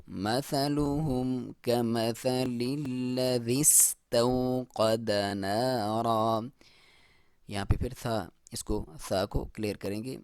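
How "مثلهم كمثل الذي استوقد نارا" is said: slow melodic tilawat; slow Quranic recitation. Shown as a demonstration. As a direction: chant each word long and measured.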